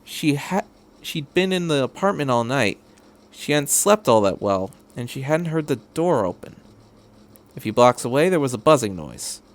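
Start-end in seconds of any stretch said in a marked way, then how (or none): none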